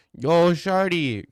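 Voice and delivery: put-on loud, high, drawn-out Toronto accent. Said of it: "Toronto accents are kinda growing on me".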